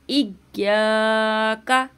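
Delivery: very slow recitation, इय्याका with ya doubled clearly. Shown as a demonstration. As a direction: hold the shadda in इय्याका. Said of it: "हम तशदीद को जाहिर करेंगे". (इय्याका में तशदीद ज़ाहिर करना)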